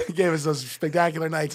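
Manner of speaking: real laughter through the words, loud and high; laughing. Real, not acted. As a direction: laugh it out at the joke. Laughing at the favour.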